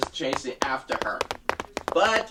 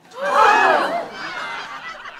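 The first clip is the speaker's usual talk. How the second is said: loud high shocked gasp; big high gasp; crowd gasp; 6 dB louder than usual. Acted. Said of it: "All the people were shocked".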